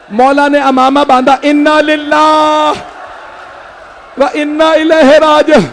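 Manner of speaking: mourning chant building into a cry; mourning recitation. Acted. Majlis lament rising to wail.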